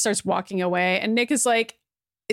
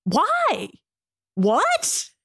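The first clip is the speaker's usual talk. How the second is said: one-word questions climbing higher, breaking into laughter; spaced-out, rising squawks of mock bewilderment; baffled disbelief; 8 semitones higher, much slower than usual. Reacting in disbelief to the plot.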